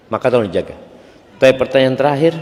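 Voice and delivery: ordinary talk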